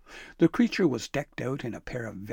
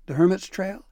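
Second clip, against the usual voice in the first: put-on higher, louder old man reply; grandpa voice; 8 semitones higher, 9 dB louder than usual. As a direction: boom it out like grandpa. Grandpa answers, voiced character.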